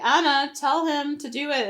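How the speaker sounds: mock motherly urging; imitated pleading mom. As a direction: play a mom pushing her to step in. Acting mom ordering Anna.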